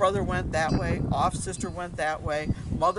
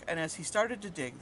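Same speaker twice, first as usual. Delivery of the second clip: usual speaking voice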